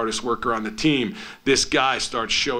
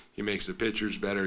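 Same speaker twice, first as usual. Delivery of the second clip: normal talking voice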